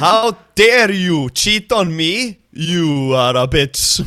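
high, loud, slow fake fury in an Icelandic accent; mock angry in Icelandic accent. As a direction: try to sound furious but can't, Icelandic accent. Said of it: "impossible to sound angry in".

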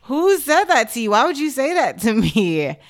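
loud, high-pitched mock outrage; taken aback. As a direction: exaggerate the shock, amused underneath. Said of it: "taken away".

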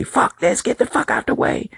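high, pinched mock yell of the boss; mock hollering boss. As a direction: ham up the boss barking orders. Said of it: "dude just was hollering at me".

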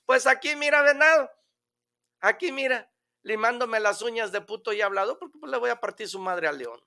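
high, fast boastful voice; monkey bragging to the deer, high and fast; cocky little monkey. Acted. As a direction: brag like a lazy little monkey. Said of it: "el changuito con la pata cruzada".

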